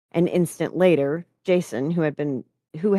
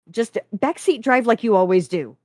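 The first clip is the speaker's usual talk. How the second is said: loud, raised, biting interruption; acid retort; 6 semitones higher, 6 dB louder than usual. A narrator's reading. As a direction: cut in with an acid jab.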